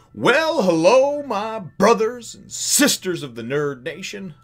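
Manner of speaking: drawn-out, high-pitched host greeting; hyped show intro. Acted. Host welcoming the Nerd Nation to his review, hyped up. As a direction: stretch every phrase like an excited announcer.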